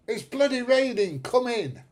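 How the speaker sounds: exasperated call to the dog. Real, not acted. Calling the dog in.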